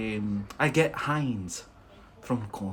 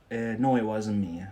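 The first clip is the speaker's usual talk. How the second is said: normal talking voice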